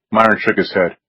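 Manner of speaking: usual speaking voice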